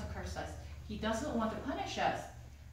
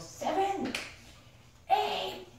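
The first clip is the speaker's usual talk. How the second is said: counting shout; 10 semitones higher, much slower than usual. Retold or imitated. Mom skipping counts.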